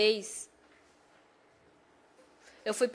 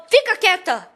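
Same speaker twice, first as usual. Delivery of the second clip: sharp retold command, higher and louder; snapping; 4 semitones higher, 4 dB louder than usual. Husband silencing his worried wife mid-quarrel, snapping. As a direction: cut off the pleading with a loud order.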